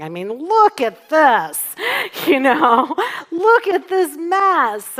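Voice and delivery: loud, drawn-out self-congratulation; mock amazement. Speaker voices the proud ego to the congregation, mock amazement. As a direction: marvel loudly and slowly at your own mess. Acts out ego admiring its mess.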